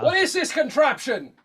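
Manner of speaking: high, astonished put-on voice; a Civil War-era Englishman staring at a camera; baffled old-timey caller. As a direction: gawp at the strange machine.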